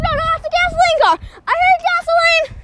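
high, loud outburst; outraged shout. Real, not acted. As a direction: yell your disgust at the car. Kid outraged at gasoline car.

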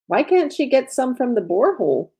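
high-pitched, exasperated question; pained objection. A narrator's reading. Cecile questions her translator about water, pained and protesting. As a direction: object in a high voice with a swoop on the last word. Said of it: "Cecile grimaced".

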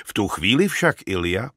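plain reading voice

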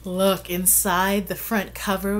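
ordinary delivery, nothing marked

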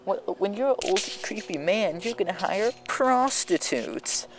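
put-on offended girl. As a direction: imitate her huffy comeback. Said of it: "she had got a bit offended".

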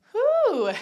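high, loud winded exhale; out of breath, puffing out a whew; breathless whew. Real, not acted. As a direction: blow out a tired whew after the squats. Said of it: "I'm out of breath".

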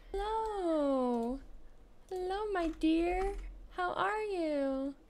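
put-on high, slow baby-greeting voice; cooing at a baby. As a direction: coo at the newborn like a doting parent.

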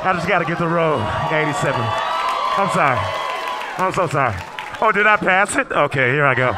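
loud awkward sorry. Pretend. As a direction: call out sorry while squeezing through the row. Squeezing past to seat.

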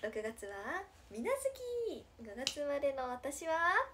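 idol catchphrase, stretched and sung out at the end; catchphrase call. Shown as a demonstration. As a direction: chant it out, drawing out the name. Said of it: "ってやつやろ？".

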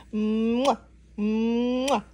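two hummed smooches; kissing sounds. Acted. Mama llama kisses her baby, playful smooches. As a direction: smack out a big playful kiss. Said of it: "two kisses from his mama".

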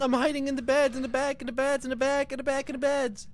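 high, loud and rushed; frantic loud calling. Pretend. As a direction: yell in a panic while hiding. Hiding from the warden in panic.

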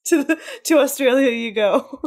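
loud laughing judge's verdict; laughing mock sentence. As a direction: pronounce the sentence while cracking up.

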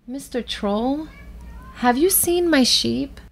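put-on high, timid little-girl question; little Bo Peep. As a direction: ask the troll in a small high voice. Small girl asks a troll.

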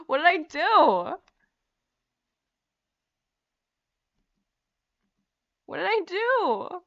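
high, loud, drawn-out lament; mock-crying wail. Real, not acted. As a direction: wail it in comic despair. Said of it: "I'm crying".

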